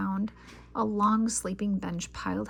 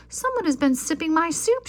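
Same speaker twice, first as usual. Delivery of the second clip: higher, louder angry growl; 8 semitones higher, 6 dB louder than usual. A narrator's reading. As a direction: growl it as mama bear. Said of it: "she growled".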